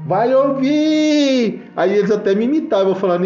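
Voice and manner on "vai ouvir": high, very drawn-out chorus reply; children answering together. Retold or imitated. Children answering the teller's question, singsong chorus. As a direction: stretch it like kids chanting an answer.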